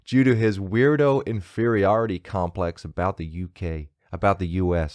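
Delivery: loud put-on American accent. Host retrying a fumbled review line, emphatic American accent. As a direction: push hard on a fake American accent. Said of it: "This, this accent shit".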